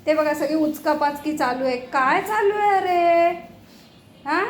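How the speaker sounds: ordinary talk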